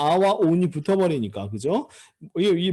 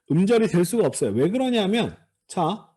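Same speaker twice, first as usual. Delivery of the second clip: ordinary talk, nothing marked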